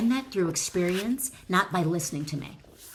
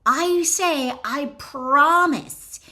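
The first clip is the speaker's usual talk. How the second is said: emphatic; 7 semitones higher, 6 dB louder, much slower than usual. Real, not acted. Coach emphatically promising results.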